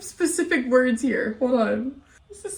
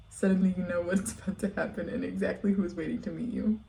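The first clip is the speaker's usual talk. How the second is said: lightly spooky reading; story narration; 4 semitones lower, 8 dB quieter than usual. Acted. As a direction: read it like a campfire tale. Reads creepypasta aloud.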